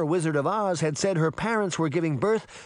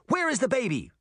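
high, hard demand; girl demanding the truth; 11 semitones higher, faster than usual. A narrator's reading. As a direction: insist on an answer now. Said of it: "she demanded".

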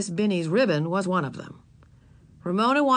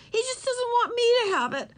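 put-on little girl voice. A narrator's reading. Ramona voiced.

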